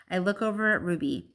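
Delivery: ordinary delivery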